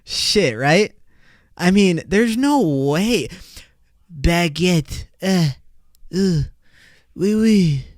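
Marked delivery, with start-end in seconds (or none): 4.1-7.8 hammed-up French caricature; mock French accent. Podcaster mocking French as unromantic, mock French accent. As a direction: make fun of spoken French with a silly French accent.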